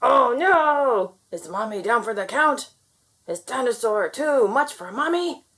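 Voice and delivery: plain reading voice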